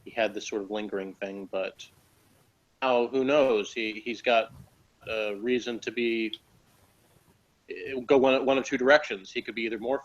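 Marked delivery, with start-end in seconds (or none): none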